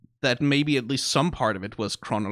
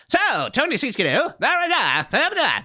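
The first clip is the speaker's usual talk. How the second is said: put-on old-timey radio voice; 9 semitones higher than usual. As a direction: pitch it up like a 1950s broadcast. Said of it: "old-timey radio voices sound like this".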